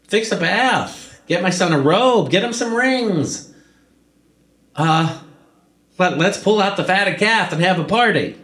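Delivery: high, loud orders thrown across the house; calling to servants. Acted. Father calling the servants to prepare a feast, loud and high. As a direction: call out joyfully for the servants to get things ready. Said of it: "he yells to, to the servants".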